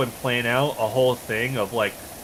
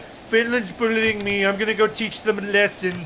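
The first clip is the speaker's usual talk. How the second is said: whiny Soviet Union; 9 semitones higher than usual. Retold or imitated. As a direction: whine like a bully playing victim. Mocking Soviet whining.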